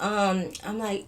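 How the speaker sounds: ordinary talk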